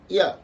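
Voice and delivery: ya short and falling sharply; fourth tone. Shown as a demonstration. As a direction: Mandarin falling tone on ya. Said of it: "ça va faire quoi".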